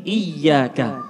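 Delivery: IYYAKA with the y held long; doubled y. Shown as a demonstration. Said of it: "ada tasydid". (iyyaka dengan tasydid ditekan)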